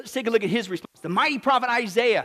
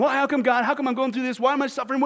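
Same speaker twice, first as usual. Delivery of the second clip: raised, pressing voice of a complaining Job; 5 semitones higher, 3 dB louder, faster than usual. Acted. Preacher mimics Job questioning God, complaining. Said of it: "When Job is saying".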